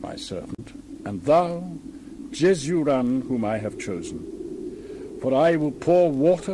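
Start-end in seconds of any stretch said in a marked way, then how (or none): none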